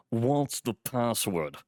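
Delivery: slow, gruff doorman voice. A narrator's reading. Doorman asks a visitor for the password, gruff. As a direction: demand it gruffly and slowly. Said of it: "the man asked gruffly".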